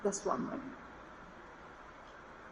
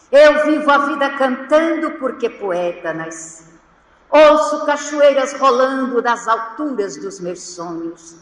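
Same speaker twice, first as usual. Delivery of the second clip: poem recitation; 7 dB louder than usual. Acted. Speaker recites a poet's verses to the congregation, ringing declamation. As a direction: declaim the poem grandly, projecting each line.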